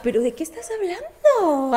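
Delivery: hint of an Argentine lilt; mock Argentine accent. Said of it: "así como argentina".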